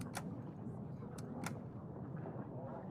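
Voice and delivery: long, very soft exasperated sigh; long weary sigh. Real, not acted. Player sighing softly at an unavailable character. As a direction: let out a long tired sigh. Frustrated player at failed option.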